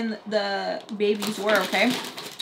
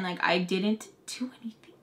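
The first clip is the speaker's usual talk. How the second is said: whispered, much softer; 2 semitones lower, 9 dB quieter than usual. Real, not acted. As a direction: breathe it under your voice.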